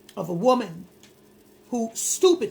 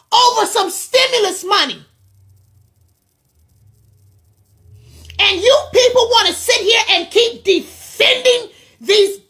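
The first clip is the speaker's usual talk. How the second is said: much higher and louder, angry voice cracking into crying; furious, near tears; 10 semitones higher, 11 dB louder than usual. Real, not acted. Scolding viewers who defend the killer, raging and breaking into sobs. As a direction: shout the grief out, fighting back tears. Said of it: "I'm trying not to cry, I'm so angry".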